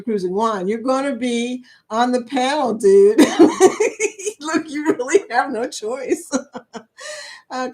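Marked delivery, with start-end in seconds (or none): 3.2-4.4 laughing. Real, not acted. Laughing at chat joke.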